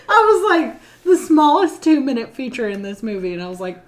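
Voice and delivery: normal talking voice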